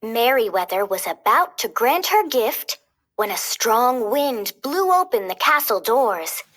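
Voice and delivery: plain reading voice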